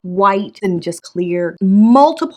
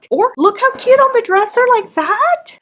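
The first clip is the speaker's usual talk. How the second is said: high, loud delighted squeal; excited squeal; 5 dB louder than usual. Real, not acted. Gushing over finished shelf.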